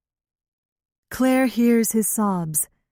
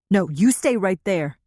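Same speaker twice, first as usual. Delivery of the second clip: shouting. A narrator's reading. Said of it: "the tall boy shouts".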